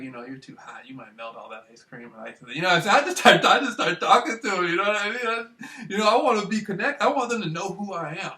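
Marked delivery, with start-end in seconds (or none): 0.0-2.5 soft, low, calm near-whisper; quietly charming the woman in the store, hushed; hushed flirting. Retold or imitated. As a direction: murmur the compliment. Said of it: "I'm all whispering, all calm".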